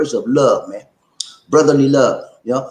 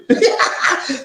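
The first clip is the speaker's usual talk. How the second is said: high teasing burst of laughter; 12 semitones higher than usual. Real, not acted. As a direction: laugh at the people you're teasing. Laughs while teasing listeners.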